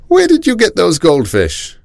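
high-pitched questioning grown-up; neighbour's mother. A narrator's reading. Nathan's mother quizzing the boy at the door, high-voiced. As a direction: pitch it well up, quizzing the child.

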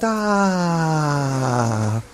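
long sigh sliding down; contented baby sigh. A narrator's reading. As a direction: imitate a soothed baby settling. Imitates the soothed baby.

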